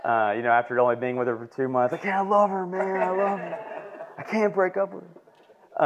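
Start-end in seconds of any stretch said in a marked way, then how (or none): 1.9-5.8 lovesick pleading. Retold or imitated. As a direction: ham up the hopeless young lover. Retelling lovesick younger self.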